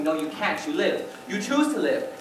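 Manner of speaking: ordinary delivery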